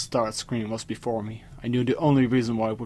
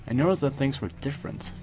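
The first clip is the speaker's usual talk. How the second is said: plain reading voice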